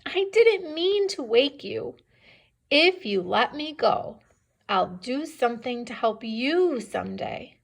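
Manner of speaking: earnest, high-pitched plea; pleading mouse. Acted. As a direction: plead like a small mouse, voice lifted, then easing down. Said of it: "But the mouse said".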